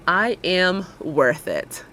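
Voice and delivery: very slow, evenly spaced words; slow affirmation. Shown as a demonstration. Host leading viewers to repeat along, slow affirmation. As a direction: say it slowly so others can join in.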